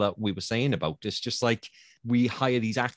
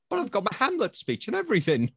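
high, pleading mock whine; a high mock-pleading Welsh actor; whiny pleading actor; 13 semitones higher than usual. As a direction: beg to be let in, pitched way up. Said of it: "It's like".

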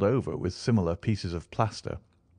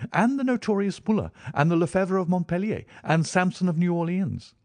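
rising enthusiasm; 12 semitones higher, 4 dB louder than usual. Acted. Listing cases, climbing pitch.

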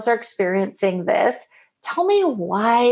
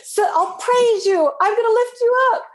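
higher and climbing, eager mock pledge; mock eager rescuer; 10 semitones higher than usual. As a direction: ham up the rescuing parent, rising. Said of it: "They're like".

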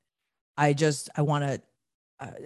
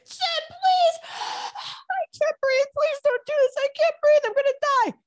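much higher, tearful pleading for breath; mother pleading, crying; 4 dB louder than usual. Retold or imitated. Mother begs her son at 4 a.m., crying and breathless. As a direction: beg him to stay while choking on tears. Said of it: "And she's crying".

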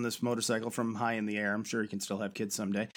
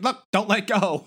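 mock shout; 8 semitones higher, 8 dB louder than usual. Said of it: "She's like".

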